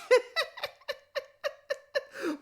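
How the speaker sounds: long high laugh; real laugh. Real, not acted. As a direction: burst out in high, stuttering laughter. Laughs after saying he's just cautious.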